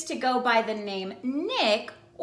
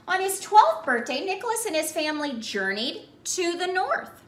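lively storybook telling, a little higher; story narration; 4 semitones higher than usual. A narrator's reading. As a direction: tell it like a storyteller to children. Reads the Santa's tale on.

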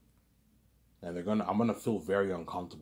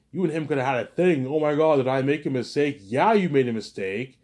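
louder, higher pretend coworkers scolding her; mock scolding coworkers; 5 semitones higher, 7 dB louder than usual. Coworkers scold the girl for rejecting Ivan, louder and higher. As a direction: play the coworkers telling her off.

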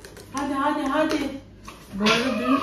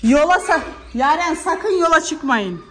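loud, higher, drawn-out warning; insistent raised warning, slowing on the name; calling out; 7 semitones higher, 5 dB louder, slower than usual. Real, not acted. Mother calls kids outside.

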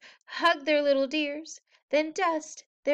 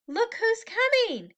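high, eager announcement; excited; 10 semitones higher than usual. A narrator's reading. Reader to preschoolers, excited. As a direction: point it out with delight.

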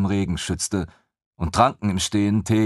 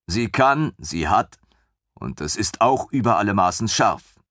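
usual speaking voice

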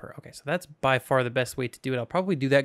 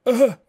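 a sudden high, loud squeak; squeaky sarcastic uh-huh; 8 semitones higher, 11 dB louder than usual. Pretend. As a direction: squeak it out in mock agreement.

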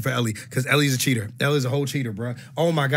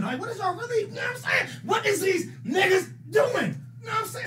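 high, strained exasperated shout; disbelieving yell; 4 dB quieter than usual. Real, not acted. Outraged at bad push-ups, from afar.

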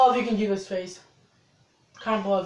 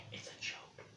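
whispered aside, the second slower; 3 dB quieter, slower than usual. Acted. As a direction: let the secret slip in a whisper. Confessing the car joke.

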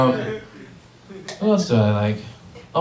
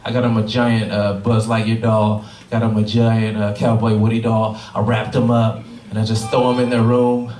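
normal talking voice

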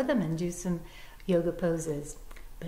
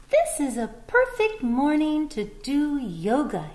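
higher, happy little bunny voice; a bunny greeting a perfect morning; cheerful bunny; 7 semitones higher, 6 dB louder than usual. Acted.

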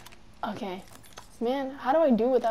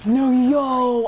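excited yell; 9 dB louder than usual. Real, not acted. Just won the fight.

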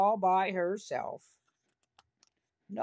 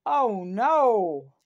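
alarmed cry; 6 dB louder than usual. Acted. Hero sees wagon rolling away.

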